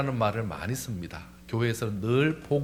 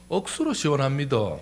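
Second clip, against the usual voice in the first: Gyeongsang dialect. Shown as a demonstration. Preacher showing Gyeongsang for feeling refreshed, Gyeongsang accent. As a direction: say it like a Gyeongsang local. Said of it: "경상도는요".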